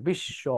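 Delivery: বিশ্ব as "bish-sho", halting on the sh; stop mid-word. Shown as a demonstration. Teacher showing pupils where it stops, বিশ্ব as "bish-sho". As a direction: hold the doubled sh in বিশ্ব, then "sho". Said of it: "হসন্ত হবে সেখানে".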